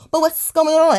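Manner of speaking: taunting imitation of the girlfriend's question; mocking the goofy girl. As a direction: squeak it out like a clueless girl. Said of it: "one of them little goofy ass fucking girls".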